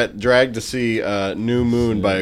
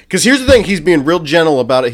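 much higher and louder, eager build-up; pitching it high and loud to a friend; excited emphasis; 6 semitones higher, 8 dB louder than usual. Real, not acted. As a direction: sell the point with big excitement. Hyped pitch about Henry Cavill.

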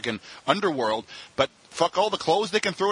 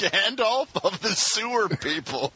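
amused savoring; 5 semitones higher, slower than usual. Real, not acted. Savoring a funny phrase.

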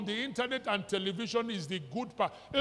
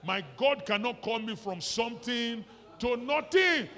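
slow, louder, higher mock protest; mock indignant cry; 4 semitones higher, 7 dB louder than usual. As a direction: wail it like an offended believer. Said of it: "Is that not how we say it now?".

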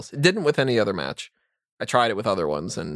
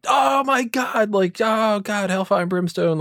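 high, drawn-out wail of a wrestling commentator; mock commentator hysterics, pitched way up; Jim Ross; 10 semitones higher, slower than usual. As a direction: oversell it as a dramatic ringside announcer. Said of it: "Jim Ross, he'd be like".

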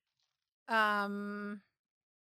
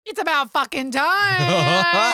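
laughing rant; 5 semitones higher, 8 dB louder, slower than usual. Real, not acted. Venting about Monet finally blocked.

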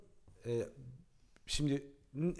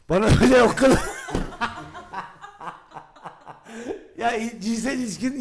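laughing; 6 semitones higher, 7 dB louder, faster than usual. Retold or imitated. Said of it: "sana peki dedi ki".

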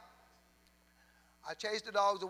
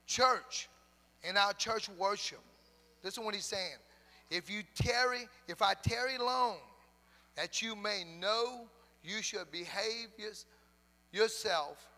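loud, drawn-out scripture proclamation; preaching scripture; 3 semitones higher, 5 dB louder, slower than usual. Real, not acted. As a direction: proclaim the verse slowly to the church.

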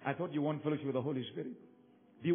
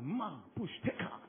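mock tongues; 5 semitones higher, 5 dB louder than usual. Mimics colleague speaking in tongues.

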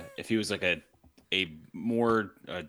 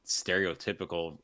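ordinary talk, nothing marked

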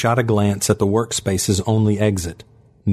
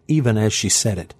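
plain reading voice

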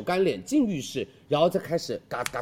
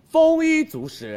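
drawn-out blessing; 12 semitones higher, 6 dB louder, much slower than usual. Acted. Hosts finish a lucky blessing.

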